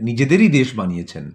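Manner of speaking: usual speaking voice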